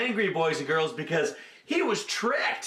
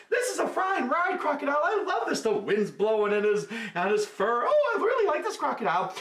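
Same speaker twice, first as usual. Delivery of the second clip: delighted monkey; 8 semitones higher than usual. Acted. Monkey enjoying ride.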